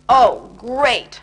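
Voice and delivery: loud sarcastic grumble; grumpy sarcasm. Acted. Grumpy Bear annoyed at travelling, loud sarcastic grumble. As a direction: sneer it like a cranky bear. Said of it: "said Grumpy Bear grumpily".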